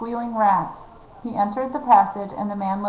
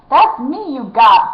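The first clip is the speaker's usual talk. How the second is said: slow, loud indignant bellow; 3 semitones higher, 7 dB louder, slower than usual. A narrator's reading. Rat catcher bellows at the outlaw who grabbed him, slow and loud. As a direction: boom it out, offended. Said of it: "bellowed the rat catcher".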